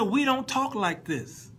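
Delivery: high, slow, emphatic reenactment; acted emphatic objection. Acts guitarist explaining bad translation.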